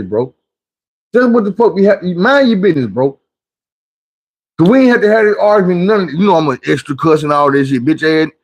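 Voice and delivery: ordinary talk, nothing marked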